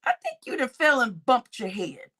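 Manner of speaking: ordinary delivery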